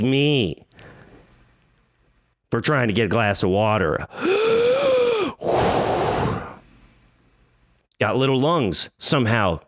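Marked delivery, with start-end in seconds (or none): 4.1-6.5 long high gasp for breath; winded gasp. Retold or imitated. Out of breath after fetching water, imitated winded gasp. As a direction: suck in air like after a sprint.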